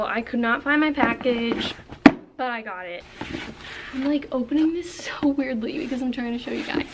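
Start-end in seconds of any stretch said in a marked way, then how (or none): none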